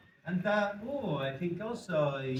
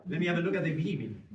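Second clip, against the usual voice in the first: ordinary talk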